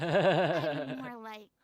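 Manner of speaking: laughing through the words, slow. Acted. Furina to friends, laughing at herself slowly. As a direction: giggle it out slowly.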